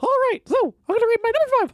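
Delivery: fast, high squeaky mimicry; high cartoon voice. Mocking the other's cartoon voice.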